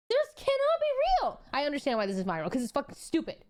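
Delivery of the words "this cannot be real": high, slow, stretched out in disbelief; can't believe it, drag it out high; stunned disbelief. Real, not acted. Streamer reading a post, high stunned disbelief.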